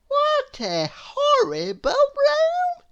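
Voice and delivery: put-on very high, slow, delighted little girl voice; Little Miss Contrary. A narrator's reading. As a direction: gush over the room as if thrilled. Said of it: "she said".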